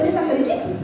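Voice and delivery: usual speaking voice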